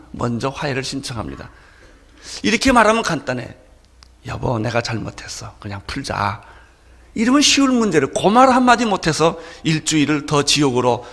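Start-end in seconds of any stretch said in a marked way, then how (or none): 4.3-6.4 softer, lower, sincere apology; gentle apology. Pretend. Spouse reconciling after a fight, softly apologizing. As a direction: quietly offer peace to your wife. Husband offering reconciliation first.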